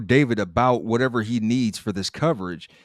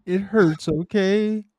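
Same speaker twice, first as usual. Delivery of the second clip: high whiny mock plea; mock hurt plea; 9 semitones higher, slower than usual. Co-host mimicking Andrea's hurt plea, high and whiny. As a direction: pitch it up like a wounded girl.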